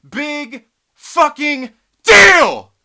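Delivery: slow, loud, scornful shout; big scornful yell. Real, not acted. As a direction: bellow it out with contempt. Ranting at chat.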